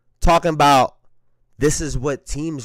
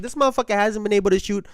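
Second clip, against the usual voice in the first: exasperated; 9 semitones higher, 7 dB louder than usual. Retold or imitated. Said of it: "I'm like".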